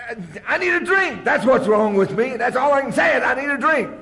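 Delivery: high, loud pleading outburst; desperate shout. Retold or imitated. Answering Tom at the meeting, desperate shout. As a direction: blurt it out like a craving drunk.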